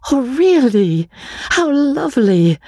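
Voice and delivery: high-pitched gushing exclamations; gushing delight. Acted. Magnolia hears of a wedding, gushing with delight. Wife gushes over wedding news.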